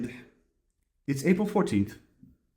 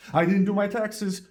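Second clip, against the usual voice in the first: higher, alarmed blurt; alarmed thought; 9 semitones higher than usual. Acted. The intrusive thought about unpaid taxes: alarmed blurt. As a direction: let the worry jump out at you.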